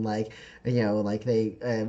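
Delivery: ordinary talk, nothing marked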